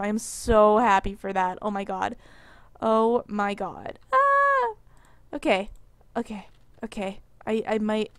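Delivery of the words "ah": excited squeal. Real, not acted.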